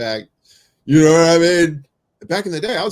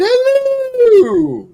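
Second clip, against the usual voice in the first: high excited greeting; 5 dB louder than usual. Pretend. Greeting Chris entering, high.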